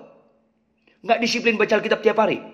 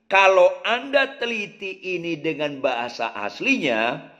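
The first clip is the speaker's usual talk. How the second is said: normal talking voice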